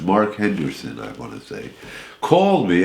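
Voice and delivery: ordinary talk, nothing marked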